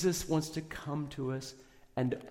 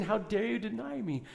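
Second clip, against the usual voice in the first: higher mock accusation; mock angry rebuke; 8 semitones higher than usual. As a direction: scold as if betrayed, but lighter. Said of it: "He didn't come to Peter and saying".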